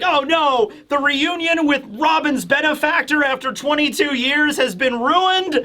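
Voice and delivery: high, hammed-up dismay; high-pitched acted lamenting; dramatic lament. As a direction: wail over a disaster in mock despair. Sanji lamenting ruined reunion.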